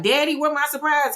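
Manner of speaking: put-on high-pitched little girl calling out; impatient child. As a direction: imitate a kid pestering Daddy. Little girl calling to her daddy.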